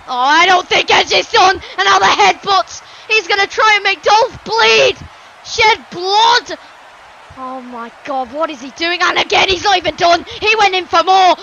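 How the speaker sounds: urgent wrestling commentary, rising on the blows; indignant ringside call; appalled play-by-play. Acted. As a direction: call a brutal beating in dismay. Outraged commentary on the attack.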